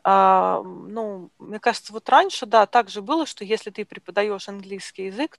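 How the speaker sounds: ordinary talk, nothing marked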